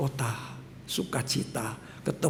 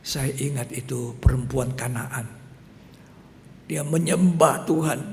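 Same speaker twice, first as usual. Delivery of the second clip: ordinary talk, nothing marked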